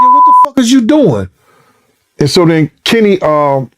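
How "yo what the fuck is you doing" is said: raised, higher indignant retort; retold angry outburst. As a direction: snap back at him in disbelief. Seat pushed back on him.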